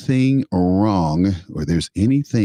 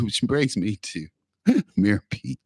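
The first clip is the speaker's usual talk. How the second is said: ordinary delivery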